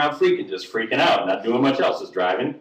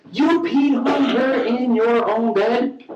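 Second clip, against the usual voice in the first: high, slow incredulous question; appalled disbelief; 7 semitones higher, slower than usual. Retold or imitated. Storyteller questions Joe about the girl, appalled. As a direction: draw out every word in mock horror.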